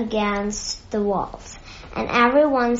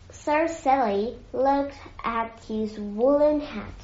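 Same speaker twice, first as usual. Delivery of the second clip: plain reading voice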